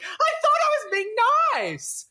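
high mock wail, dropping at the end; plaintive defence. Embarrassed partner defending the spit, high plaintive protest. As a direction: protest it high, then let the last word fall.

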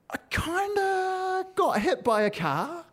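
very high, drawn-out admission; sheepish confession. Retold or imitated. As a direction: tiptoe slowly into bad news, voice way up. Breaking bad news to mum.

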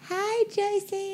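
very high, slow squeaky greeting; little mouse voice. Shown as a demonstration. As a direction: draw it out like a tiny mouse. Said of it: "weird little mouse voice".

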